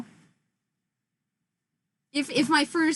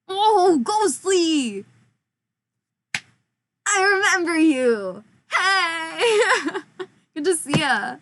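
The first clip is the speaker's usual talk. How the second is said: excited greeting; 5 semitones higher, 4 dB louder, much slower than usual. Real, not acted. Greeting returning viewer excitedly.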